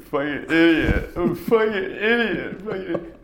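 high, strained self-berating outburst, drawn out; loud, slow, high-pitched mock self-loathing; Phil Hoffman impression. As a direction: imitate an actor's meltdown, beating yourself up. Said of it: "that scene where he's like".

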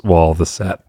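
normal talking voice

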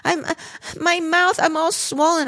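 mumbling swollen-mouth daughter. Retold or imitated. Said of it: "I can't understand her 'cause she's in my".

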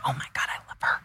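starstruck, whispered gush; retold fangirl whisper. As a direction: breathe it out like a secret crush. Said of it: "I'm like".

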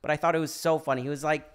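ordinary delivery

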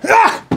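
very high, loud cry; sudden yelp. Real, not acted. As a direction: yelp as you get grabbed. Grappling demonstration on him.

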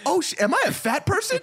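high, laughing incredulous question; hammed-up high-pitched realisation; mock dawning horror. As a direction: squeal it in comic self-doubt through laughter. Retold dawning shocked realisation.

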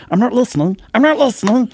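shrill-pitched Smeagol refusal; Smeagol protesting. Retold or imitated. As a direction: cover your ears and whine. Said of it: "I was Smeagol".

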